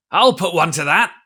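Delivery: put-on old seaman crying out; cried out. A narrator's reading. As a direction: call it out over the others. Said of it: "cried the old mahogany-faced seaman".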